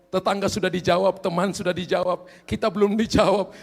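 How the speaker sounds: ordinary talk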